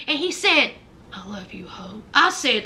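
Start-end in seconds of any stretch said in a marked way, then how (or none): 1.1-2.0 very low, hushed, creepy declaration; menacing and eerie. Retold or imitated. Imitating Arthur telling Hope he loves her, low and eerie. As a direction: breathe it out low like a horror-film stalker. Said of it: "looked at her all menacing and eerie".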